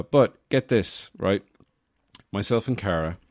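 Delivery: ordinary delivery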